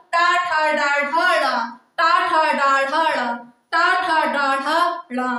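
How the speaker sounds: "टा ठा डा ढा डा" in an even chant, long vowels, falling at the end; pronunciation drill. Shown as a demonstration. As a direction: drill "टा ठा डा ढा डा" rhythmically for the class. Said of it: "बोलिएगा उच्चारण कीजिएगा हमारे साथ". ("टा ठा डा ढा डा" लय में, स्वर खींचकर उच्चारण)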